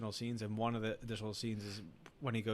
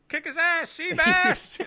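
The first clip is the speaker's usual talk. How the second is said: loud, high-pitched hollered cheer; big imitated movie shout; buddy yelling; 12 dB louder, much slower than usual. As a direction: yell it out like a rowdy buddy egging him on. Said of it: "you hear his buddy go".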